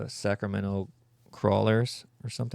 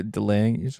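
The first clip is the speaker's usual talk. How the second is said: ordinary talk, nothing marked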